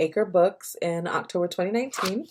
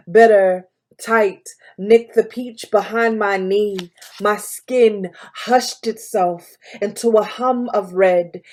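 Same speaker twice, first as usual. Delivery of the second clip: louder, emphatic spoken-word delivery; poem recital; 3 semitones higher, 7 dB louder than usual. Acted. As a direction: perform it as poetry, in charged phrases with deliberate breaks. Reads own poem aloud.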